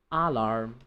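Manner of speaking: low AH-larm, weight up front; stress on first syllable. Shown as a demonstration. Teacher repeats the mistake in jest, first-syllable stress. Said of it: "you don't say alarm".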